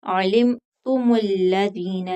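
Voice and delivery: ordinary delivery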